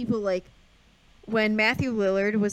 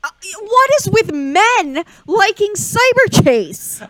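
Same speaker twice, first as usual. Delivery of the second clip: exasperated; 11 semitones higher, 10 dB louder, slower than usual. Real, not acted. Mock outrage at men's taste.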